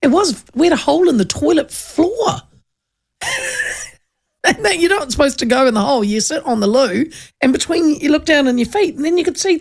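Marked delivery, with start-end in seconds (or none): 3.2-3.8 sudden squeaky laugh, much higher; high burst of laughter. Real, not acted. Host laughs recalling the hole in the toilet floor. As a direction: crack up at the memory.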